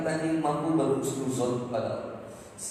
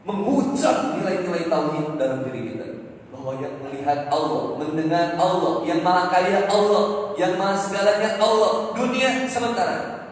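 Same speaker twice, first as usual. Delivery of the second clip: raised, ringing sermon voice, higher and louder; preaching; 9 semitones higher, 10 dB louder than usual. Acted. Preacher exhorting the congregation on lost property, full force. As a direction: preach it out to the crowd.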